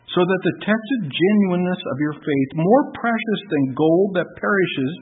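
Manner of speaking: loud, rising preached reading; scripture reading. Acted. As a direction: proclaim the passage from the pulpit. Reads First Peter aloud.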